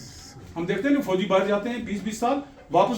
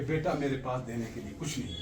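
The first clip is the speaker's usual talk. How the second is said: soft dying father; 7 semitones lower, 11 dB quieter than usual. Retold or imitated. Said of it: "वालिद क्या कहता है".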